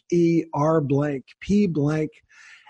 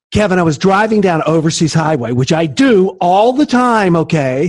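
loud, fast, worked-up complaint; acted venting; 4 semitones higher, 6 dB louder than usual. Guest shows host how complaining sounds, mock indignant rant. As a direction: play up the grievance, pushing pitch and volume. Acts out venting, unlike stating facts.

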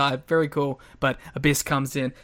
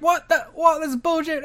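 much higher, disbelieving outburst; outraged wrestlers; 10 semitones higher than usual. Retold or imitated. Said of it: "Now you're just like".

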